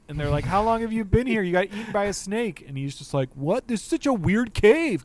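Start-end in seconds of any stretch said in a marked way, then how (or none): 0.4-2.6 high, louder retold disbelief; incredulous. Retelling characters' incredulous question. 3.3-5.0 high, loud cartoon surprise; clueless astonishment. Retelling clueless captain's reply.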